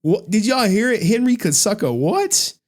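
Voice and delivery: ordinary delivery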